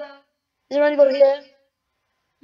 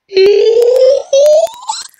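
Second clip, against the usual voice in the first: high-pitched effect laugh; 10 semitones higher, 8 dB louder than usual. Real, not acted. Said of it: "I don't want to try that one again".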